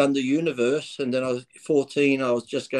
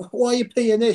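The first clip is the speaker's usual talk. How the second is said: 1 incredulous; 10 semitones higher, 3 dB louder than usual. Retold or imitated. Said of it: "I said".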